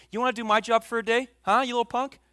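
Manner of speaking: a parent scolding their kid, lightly hammed-up; mock scolding parent. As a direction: snap it like an annoyed dad. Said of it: "how many parents have you said that to your kids".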